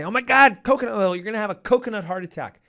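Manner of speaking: high, loud mock panic, then a fast finish; hammed-up doctor panicking over coconut oil; panicked cardiologist. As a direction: play the freaked-out cardiologist. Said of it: "gonna panic and freak out and say".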